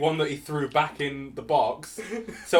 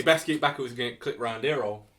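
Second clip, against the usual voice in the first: Nottingham slang; 6 semitones lower, 5 dB quieter than usual. Shown as a demonstration. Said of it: "Go in sections".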